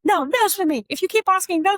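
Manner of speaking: mock older sibling scolding; sibling telling off. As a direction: shut the little brother down. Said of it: "And then they're like".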